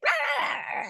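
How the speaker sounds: high squawking roar imitating mom; mom's mock angry roar. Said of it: "she's like".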